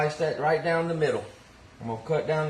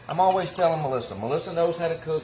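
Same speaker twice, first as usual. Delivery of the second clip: normal talking voice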